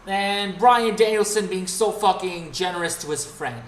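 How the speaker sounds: usual speaking voice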